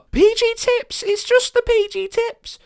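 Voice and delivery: very high, loud, slowed cry; shrill neighbour. Acted. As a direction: wail for the plain tea. Crowd offering teas.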